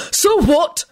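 barked. Acted. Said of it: "he barked back".

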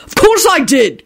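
high, spiteful snap; indignant and nasty; nasty retort. A narrator's reading. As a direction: throw it back sharply, pitch jumping up. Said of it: "Quentin replied nastily".

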